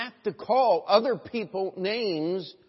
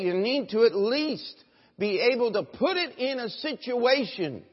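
raised, higher, forceful sermon voice; preaching at full force; 7 semitones higher, 8 dB louder than usual. Real, not acted. Preacher urging the church to put away lying, forceful exhortation. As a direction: drive it home to the congregation.

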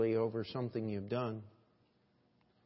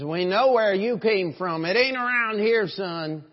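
loud, raised-pitch challenge; acted accusation; 7 semitones higher, 9 dB louder than usual. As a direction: call him out loudly as the accuser. Accusers of Peter voiced.

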